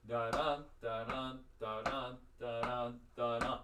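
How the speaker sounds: da-DA chanted, stress on the second beat; iambic beat. Shown as a demonstration. Teacher demonstrating the beat while walking in place. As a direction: chant the beat for them to watch. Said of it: "now do it with me".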